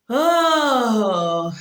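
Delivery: loud, long falling "oh"; overwhelmed "oh". Retold or imitated. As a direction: let out a big drawn-out wail. Re-enacting her overwhelmed reaction.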